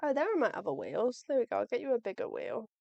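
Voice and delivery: normal talking voice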